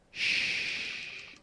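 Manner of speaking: imitated whispered shush; retold shush. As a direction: hush someone like a sniper in hiding. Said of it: "he was like".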